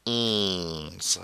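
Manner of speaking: "Onze" with a drawn-out nasal vowel; slow, nasal model of "Onze"; through the nose. Shown as a demonstration. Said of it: "Get it coming through the nose".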